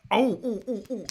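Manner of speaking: high-pitched run of repeated ohs; excited ohs. Real, not acted. Streamer suddenly reminded of air bubbles, excited ohs.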